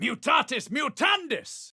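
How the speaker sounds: triumphant spell shout. Acted. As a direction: cry out the spell. Spell cast in combat.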